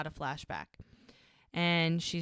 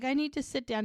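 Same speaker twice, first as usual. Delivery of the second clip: higher, weary plea; pleading wife; 8 semitones higher, 3 dB louder than usual. Retold or imitated. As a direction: beg to stop, worn out. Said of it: "she's like".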